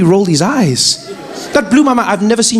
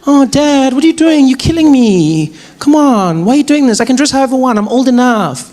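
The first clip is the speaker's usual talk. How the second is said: put-on whiny teen daughter; 4 semitones higher than usual. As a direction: plead like an outraged teen. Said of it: "daughter protesting".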